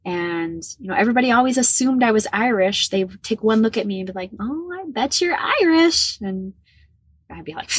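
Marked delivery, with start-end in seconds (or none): 4.4-6.2 high, slow, sing-song imitation; mock the nosy guessers in a high drawn-out voice; gushing guess. People taking one look at her, high gushing guess.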